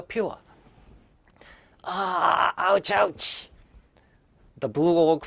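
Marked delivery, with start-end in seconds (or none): 1.8-3.5 pained ouch. Acted. Orc hits forehead on pillar.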